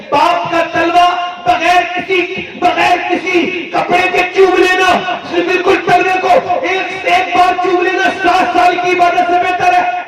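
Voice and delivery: high, fervent sermon shout; impassioned preaching. Acted. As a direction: preach it out with full force. Preaching at full force. (جوشیلا وعظ، بلند آواز میں)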